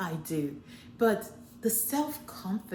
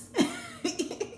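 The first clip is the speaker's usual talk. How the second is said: a short laugh, much higher. Real, not acted. Amused by his kids' slang, laughing. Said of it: "laughs at his kids' word".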